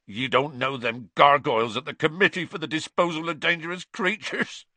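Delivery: tearful, high-rising Hagrid protest; choked up. A narrator's reading. Gamekeeper protests to his friends about the committee, choked up. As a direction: choke it out, pitch climbing at the end. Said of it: "choked Hagrid".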